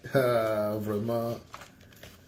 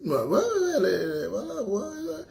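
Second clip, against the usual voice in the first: mocking high yeahs; 9 semitones higher than usual.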